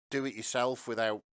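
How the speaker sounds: ordinary talk, nothing marked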